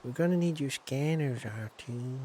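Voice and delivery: lightly voiced Luke, trailing off soft; a hint of the young Jedi calling his droid; Luke Skywalker. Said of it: "That's my Luke Skywalker impression".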